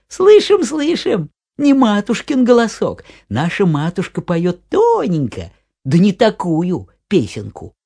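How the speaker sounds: put-on high loud children's chorus; kids' voices. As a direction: squeal like little goat kids. Kids reply to wolf.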